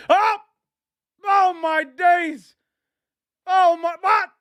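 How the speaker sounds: high, loud "oh my days" in disbelief; real shock at the keeper's mistake; shocked outcry. Real, not acted. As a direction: fan yelping in disbelief at a howler. Keeper blunder, exasperated outcry.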